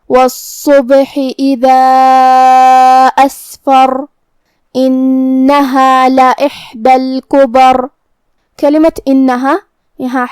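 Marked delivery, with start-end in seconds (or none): 0.1-3.9 very slow chant with a breathy س in Asfar; measured Quranic chant; tajweed recitation. Shown as a demonstration. Recites verse again. 4.8-7.8 slow chant with a doubled ن in انہا; measured Quranic chant; Quran recitation. Acted. Recites next verse.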